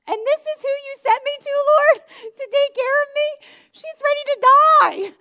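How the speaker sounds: hammed-up protest to the Lord; loud disbelieving whine to God; mock outraged plea. Mock complaint to God, laughing.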